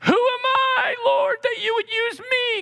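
high, pleading cry; Moses crying out. Retold or imitated. Moses crying out to the Lord, high and pleading. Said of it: "When Moses cried out".

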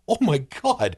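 high, astonished cry of disbelief; shocked gasp. Retold or imitated. Viewer reacting to a sudden character death, stunned. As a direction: jump way up in pitch, stunned. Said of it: "and you're like".

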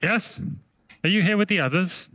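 small, high, suspicious creature voice; a tiny voice questioning through the door; little high-pitched voice. Acted. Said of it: "there's this voice going".

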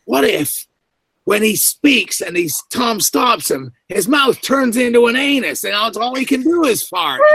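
high-pitched mock brainstorming, building; excited pitch. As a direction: imitate a director carried away by a daft idea. Director acting out his wild idea.

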